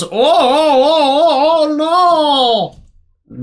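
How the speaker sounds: high, slow, stretched-out cries of terror; dying scream. Retold or imitated. Mushkin being swallowed by the plant, high slow wail. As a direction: wail while being eaten. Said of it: "And he goes".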